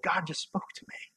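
hushed, breathless whisper of wonder; awed whisper. Retold or imitated. Preacher voicing a believer who just heard God, whispering. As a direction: whisper it like a secret revelation.

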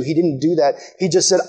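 ordinary talk

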